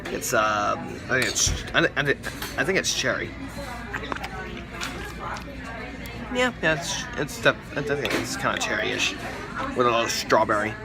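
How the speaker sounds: deep TV host voice; low host voice. Acted. Food of the day show.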